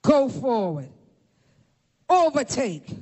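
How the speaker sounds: solemn spaced-out orders, each one falling; preacher lightly voicing God's firm commands. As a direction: issue each order as a divine decree. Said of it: "God says, "David, pursue".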